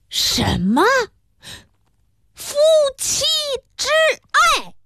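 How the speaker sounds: stunned question, then halting word by word; aghast disbelief. A narrator's reading. Pharmacist reading the book's title aloud, appalled and halting. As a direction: read out each syllable in scandalized shock, jumping high at the end.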